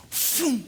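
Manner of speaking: high falling "phoom"; imitated pitch whoosh. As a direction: whoosh like a fastball going by.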